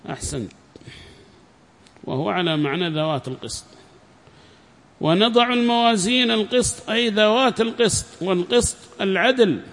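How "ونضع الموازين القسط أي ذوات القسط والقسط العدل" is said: higher-pitched formal recitation of a book; formal lesson reading voice; reading a text aloud. Acted. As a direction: read the passage out clearly to a class.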